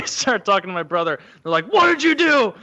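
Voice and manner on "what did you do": parent demanding. Retold or imitated. Parents questioning him.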